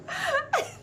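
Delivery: high squeal of laughter; tearful laugh. Real, not acted. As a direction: laugh high, close to happy tears. Overcome with happy tears.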